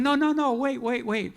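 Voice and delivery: high, loud hurried no no no wait; urgent pleading. Retold or imitated. Long-lost sister on the phone cutting him off, high urgent pleading. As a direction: rush to stop him before he hangs up.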